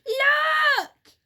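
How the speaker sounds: very high, loud shriek; scream. A narrator's reading. Missus Twit seeing the floor above, high loud shriek. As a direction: shriek in terror. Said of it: "screamed Missus Twit".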